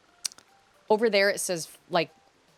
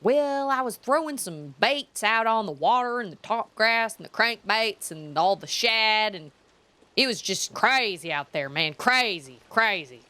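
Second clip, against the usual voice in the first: drawling Southern fishing-show host, pitched up; hammed-up Southern accent, stretched vowels; mock Southern fisherman; 5 semitones higher, 3 dB louder than usual. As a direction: imitate a TV angler with a thick Southern accent. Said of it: "all I hear is real hard".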